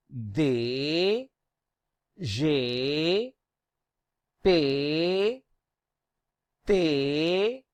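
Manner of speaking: Be, se, de with drawn-out French é; each letter stretched on ay, tune rising; long "ay" sound. Shown as a demonstration. Teacher groups similar alphabets, drilling the long ay sound. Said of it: "have the A sound".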